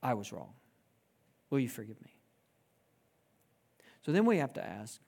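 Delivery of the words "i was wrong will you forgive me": low, soft, humble plea; sincere apology. Retold or imitated. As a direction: quietly ask forgiveness. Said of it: "somebody comes and says".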